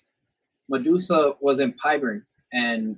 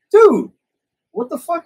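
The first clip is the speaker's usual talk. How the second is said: much higher, loud, shocked "Dude"; shocked outburst; 10 dB louder than usual. Retold or imitated. Cody reacting to hearing about the robbery, shocked yelp. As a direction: yelp it out in disbelief.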